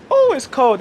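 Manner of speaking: sudden high yelp at the cold; surprised cold exclamation. Real, not acted. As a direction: blurt it out, startled by cold. Cold wind hits outside.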